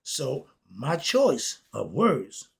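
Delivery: normal talking voice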